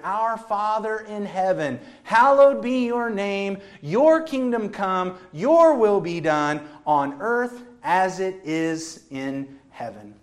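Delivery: slow, measured reading of the prayer; slow reverent recitation; solemn scripture reading. Acted. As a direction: read each phrase like a holy text. Reads the Lord's Prayer slowly.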